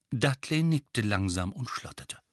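plain reading voice

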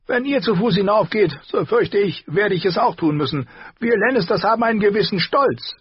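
high, barking and mocking; a sneering high dwarf who laughs at them. A narrator's reading. Tyrion voiced by narrator. (hoher, spöttischer Zwergentonfall)

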